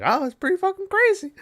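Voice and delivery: squeaky high mock voice of the fly-man; high-pitched imitation of a shrugging scientist. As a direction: pitch way up as if quoting a goofy character. Retelling the fly-man's calm remark.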